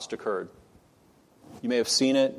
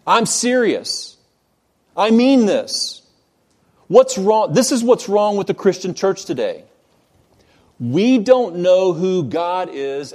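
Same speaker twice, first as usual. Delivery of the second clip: dead serious rebuke; 5 semitones higher, 6 dB louder than usual. Retold or imitated. R C Sproul answering uncomfortable laughter at a conference, loudly insistent. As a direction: press each phrase hard over the laughter. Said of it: "he couldn't be more serious".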